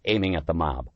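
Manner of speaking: ordinary delivery, nothing marked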